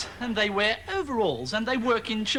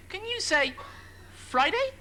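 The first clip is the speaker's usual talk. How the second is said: much higher sing-song question; condescending presenter; 9 semitones higher than usual. Acted. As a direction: coax the children to repeat Friday. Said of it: "condescending the way they spoke".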